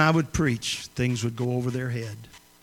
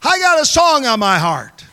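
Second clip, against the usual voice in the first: loud, high holler, slow on the last words; 12 semitones higher, 9 dB louder than usual. Retold or imitated. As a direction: call out high and loud from the pew, stretching the words. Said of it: "That's the way she talked".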